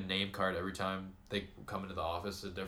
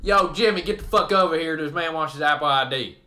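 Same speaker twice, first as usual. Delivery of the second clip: much higher, far louder call across the house; mock holler; 7 semitones higher, 12 dB louder than usual. Caller shouting for Jimmy, hammed-up yell. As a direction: bellow for someone in another room.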